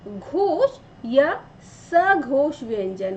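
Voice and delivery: slow, drawn-out reading of the heading; slow textbook read. Shown as a demonstration. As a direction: read it off the page slowly and clearly. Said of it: "अब यहाँ क्या लिखा है?".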